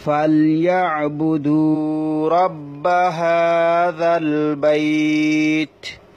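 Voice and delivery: slow, drawn-out melodic Quran chant; slow chanted Quranic Arabic for a tajweed class; Quran recitation. Shown as a demonstration. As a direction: recite it slowly with tajweed and stop on the last word. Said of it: "তারপর আসুন".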